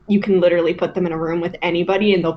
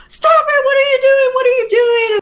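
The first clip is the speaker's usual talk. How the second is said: much higher, louder mock shout; retold yell; 4 dB louder than usual. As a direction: yell to break up a fight. Friend breaking up a fight.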